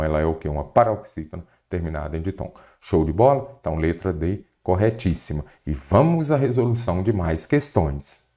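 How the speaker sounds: normal talking voice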